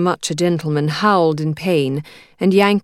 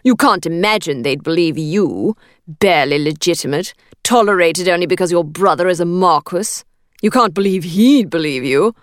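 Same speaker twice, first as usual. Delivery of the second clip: menacing man; 4 dB louder than usual. A narrator's reading. Attacker sneers at her.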